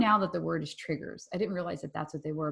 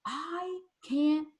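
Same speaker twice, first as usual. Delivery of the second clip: high, slow, half-hearted exasperated son; imitating her son's outburst, lightly; mock angry son; 8 semitones higher, slower than usual. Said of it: "so eloquently and so lovingly said".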